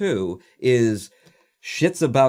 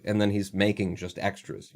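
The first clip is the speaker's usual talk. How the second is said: ordinary delivery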